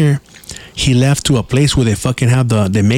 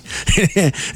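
laugh; 7 semitones higher, 4 dB quieter than usual. Real, not acted. Laughs after the punchline.

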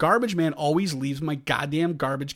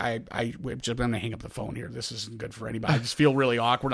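a hint of a timid mom, lower and softer; imitating mom; 6 semitones lower, 5 dB quieter than usual. Mom on the phone wanting to hang up, lightly voiced soft and low. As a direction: mumble it like an uneasy mother. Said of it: "And my mom's like".

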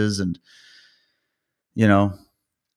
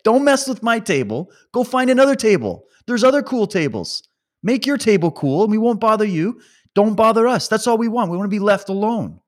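high, mocking schoolyard whine; lightly voiced high bratty snob; mock clique voice; 10 semitones higher than usual. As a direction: sneer it like a cool-table kid.

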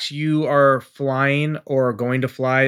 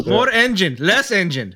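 higher, clipped coaching calls; radio coach voice; 6 semitones higher, slower than usual. Retold or imitated. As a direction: bark orders like a radio coach. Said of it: "that's what they sound like on the radio when they're coaching you".

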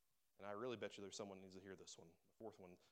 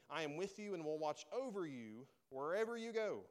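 loud, raised, emphatic reading; preaching scripture; 6 semitones higher, 6 dB louder than usual. Acted. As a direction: declaim the promise with drawn-out key words.

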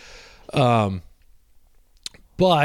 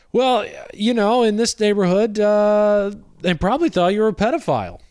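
much higher and louder gossipy voice; chatty neighbors; 8 semitones higher, 5 dB louder than usual. Retold or imitated. Trailer couple telling the canvasser they saw the yelling, high and louder. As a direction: play the curious couple leaning in for the news. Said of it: "they were like".

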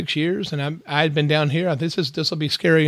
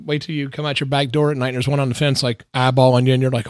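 normal talking voice

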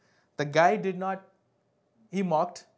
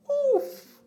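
high yelped oof of amazement; yelped oof, as if struck by the point; sharp exclamation; 8 dB louder than usual. Real, not acted. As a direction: let out a sudden, high, loud oof. Preacher exclaims at a revelation.

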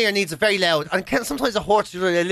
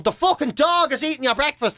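imitating mother; 7 semitones higher than usual. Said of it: "my ma standing in front of him going".

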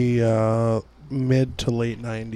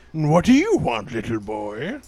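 Santa voice; 3 semitones higher than usual. Pretend. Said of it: "tell Santa".

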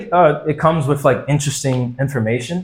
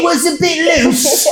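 mock British accent; 13 semitones higher, slower than usual. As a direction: proclaim it like an overblown British critic. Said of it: "British people can say anything".

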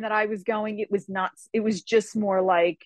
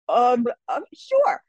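hesitant mock agreement; 2 semitones higher, 4 dB louder, much slower than usual. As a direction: agree while clearly caught off guard. Her hesitant reply to fighting.

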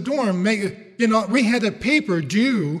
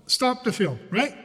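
ordinary talk

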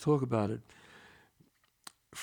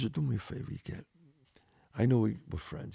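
retold hushed warning; 6 dB quieter than usual. Made friend pulling his buddy aside, hushed warning. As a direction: lean in and warn him softly.

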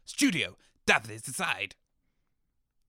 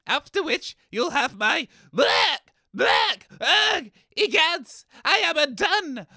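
hammy death throes; 6 semitones higher than usual. Acted. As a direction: overplay a poisoning death. Character poisoned, dying dramatically.

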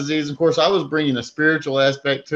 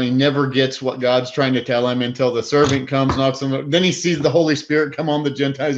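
normal talking voice

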